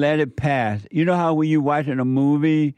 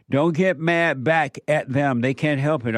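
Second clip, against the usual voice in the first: ordinary delivery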